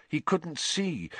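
ordinary delivery

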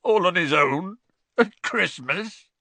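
high, strained, slowing at the end; choked half-sob of a big man. Acted. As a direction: voice cracking as it rises. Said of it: "choked Hagrid".

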